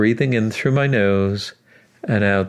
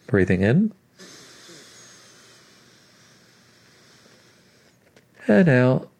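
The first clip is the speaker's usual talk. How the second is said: guided breathing cues; 3 dB quieter than usual. Acted. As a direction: count the breaths gently, unhurried.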